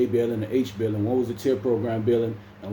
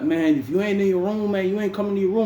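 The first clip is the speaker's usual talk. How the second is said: higher, stern warning; officer scolding; 9 semitones higher than usual. Retold or imitated. Officer warns the absent bunkmate, higher stern scolding. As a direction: scold like the guard.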